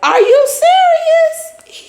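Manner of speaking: squeaked way up high and slow, outraged; imitating her stunned high-pitched disbelief; shocked fiancée. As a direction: shriek it in a mock-incredulous falsetto. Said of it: "She said".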